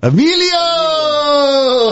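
drawn-out high shout of Emilio!; excited shout. Real, not acted. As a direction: nail the answer at last, stretching the name long. Remembering the name, game answer.

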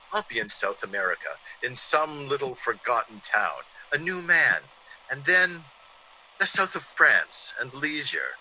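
normal talking voice

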